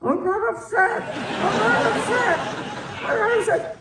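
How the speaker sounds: high, laughing protest; retelling how she protested into Crawford's chest, laughing and high. Retold or imitated. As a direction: insist through laughter, muffled. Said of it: "I'm saying".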